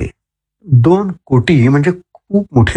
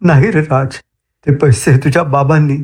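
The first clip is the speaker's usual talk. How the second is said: usual speaking voice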